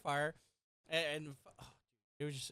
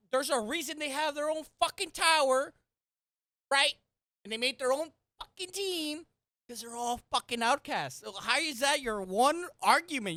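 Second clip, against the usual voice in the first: loud heated ranting; angry rant; 7 semitones higher, 7 dB louder than usual. Real, not acted. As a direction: tear into the argument. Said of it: "Rage moment".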